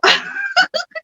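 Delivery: a short high laugh; high burst of laughter. Real, not acted. Amused at her own story, laughing high. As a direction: laugh out at the memory.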